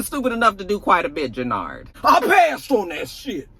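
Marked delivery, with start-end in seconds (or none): 2.0-3.5 high, drawn-out mock protest; protesting denial. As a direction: stretch out an indignant, acted denial. Acted denial under accusation.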